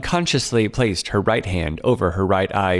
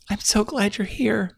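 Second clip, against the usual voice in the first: higher, relieved frightened woman's voice; frightened young woman; 13 semitones higher than usual. Acted. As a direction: play Claire, scared and clinging to hope. Frightened client Claire voiced.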